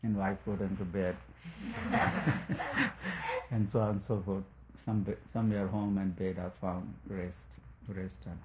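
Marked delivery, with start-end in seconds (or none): 1.2-3.5 high, light laugh. Real, not acted. Speaker amused at wife putting drunk husband to bed, laughing.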